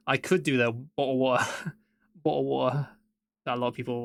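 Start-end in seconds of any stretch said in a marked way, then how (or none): 0.7-1.4 mock British "bottle of water" with dropped t's; exaggerated British glottal stops in "bottle of water". Shown as a demonstration. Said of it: "think all British people sound like that". 2.2-2.9 mock British "bottle of water" with dropped t's; exaggerated British glottal stops in "bottle of water". Shown as a demonstration. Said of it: "think all British people sound like that".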